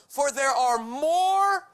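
preaching proclamation. Acted. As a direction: proclaim it like a promise.

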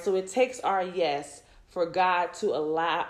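normal talking voice

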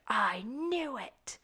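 high, drawn-out mock "aha"; mock vindication. Podcaster imitates prejudiced neighbor at the bear's arrest, mock vindication.

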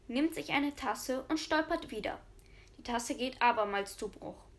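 ordinary talk

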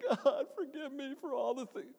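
mock frantic prayer. Student at Bible school praying, mock frantic high plea. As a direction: squeak out a mock desperate confession. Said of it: "I was like".

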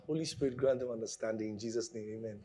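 low, hushed prayer; quick prayer. Real, not acted. Preacher praying for congregation's understanding, low and soft. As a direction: pray softly under your breath. Brief prayer amid teaching.

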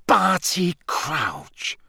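slow, drawn-out shocked recognition; stunned exclamation. Acted. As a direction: say the name in disbelief. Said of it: "stopping dead in the doorway".

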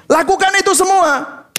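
high hammed-up order; mock command. Preacher parodies legalist preacher, high mock command.

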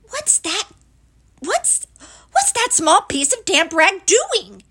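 high-pitched, rising alarm; small creature in growing panic; alarmed Piglet. Acted. Piglet finds his balloon burst.

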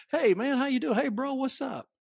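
high, easy hello; casual buddy greeting. Shown as a demonstration. Showing the congregation an everyday Southern hello, casual greeting. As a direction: greet a pal in passing. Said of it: "that's one of".

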